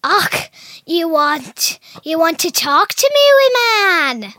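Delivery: loud, high Scottish impression; Scottish accent. Shown as a demonstration. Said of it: "what can you say in Scottish".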